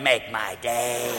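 put-on low, slow tough-guy old lady; gun-toting old lady. Old lady with grenade launcher dares you, slow threat. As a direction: drawl it softly, low and menacing. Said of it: "one little old lady with a grenade launcher".

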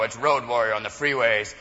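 ordinary delivery

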